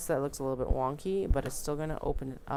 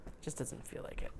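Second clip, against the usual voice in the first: whispering; 8 dB quieter than usual. Real, not acted. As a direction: whisper "It just doesn't feel like it" softly to yourself. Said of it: "I'm just giving myself a pep talk".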